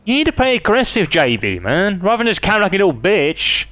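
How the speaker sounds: high-pitched, disbelieving rant; exasperated rant. Real, not acted. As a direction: vent your disbelief up high. Ranting at a camping opponent.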